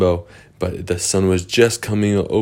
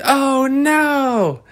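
very high character squeak of dismay; high squeaky oh no; high dismayed cry. Acted. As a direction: yelp as the net snaps up. Characters caught in net.

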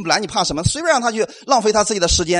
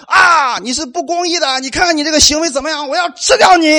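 Goliath's taunt; 6 semitones higher than usual. Acted. Preacher voicing Goliath taunting the believers, mock menace. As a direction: ham up a bullying giant's threat.

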